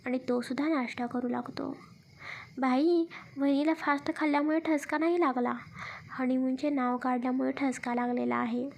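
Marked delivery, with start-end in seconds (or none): none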